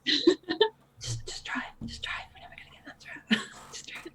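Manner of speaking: hushed unvoiced whisper. Whispering instructions to the silent driver. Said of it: "You don't have to whisper to her".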